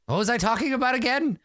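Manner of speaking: high, quick, bewildered sorcerer voice; befuddled sorcerer. Acted. Sorcerer loses his thread.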